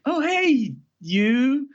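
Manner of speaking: high mock greeting. Christina Ricci greeting him, retold.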